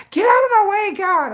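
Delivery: road rage. Retold or imitated. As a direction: imitate her fussing at traffic.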